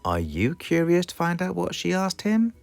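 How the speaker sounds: ordinary delivery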